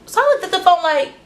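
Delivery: normal talking voice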